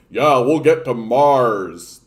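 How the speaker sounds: slow, drawn-out mock voice of a space fan; mock hype. Mocking Mars boosters, slow and loud. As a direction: drag it out like a smug Mars booster.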